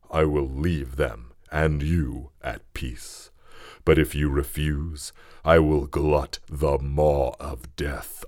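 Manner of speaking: low, slow, wounded monster voice; a grieving monster threatening its maker, low and slow; the creature's bitter plea. Acted. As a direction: menace him slowly from deep in the chest. The creature speaks to Frankenstein.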